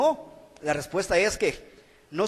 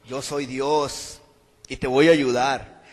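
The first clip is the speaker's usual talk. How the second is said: much lower, slow booming voice from above; voice of God; 8 semitones lower, slower than usual. Retold or imitated. God answering the hanging man, slow and low. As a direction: speak slowly and low as God. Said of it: "escucha una voz que le dice".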